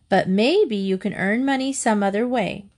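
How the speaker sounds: usual speaking voice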